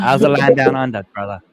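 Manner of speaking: mock Aussie accent. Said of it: "It's a good Aussie accent".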